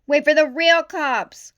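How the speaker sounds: loud, high, slowed taunt across the street; big cop taunting a small rookie; mocking call. A narrator's reading. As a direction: jeer at her to wait. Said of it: "called McHorn".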